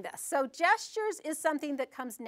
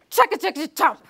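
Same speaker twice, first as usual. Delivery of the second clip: chomping noise; 4 semitones higher, 8 dB louder, faster than usual. Shown as a demonstration. Eating noise in story.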